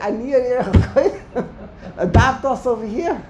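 laughing too much to finish smoothly. Real, not acted. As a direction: break up laughing mid-plea. Joking plea amid students' laughter.

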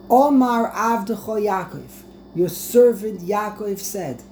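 herald's announcement, voice lifted and projected. Acted. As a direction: deliver the message high and loud. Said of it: "I want you to raise your voice".

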